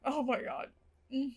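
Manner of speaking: ordinary delivery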